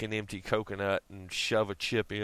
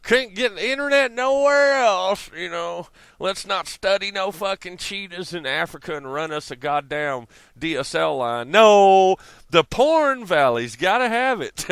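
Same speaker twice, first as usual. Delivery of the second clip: mocking voice; 8 semitones higher, 8 dB louder than usual. Mock rant, high voice.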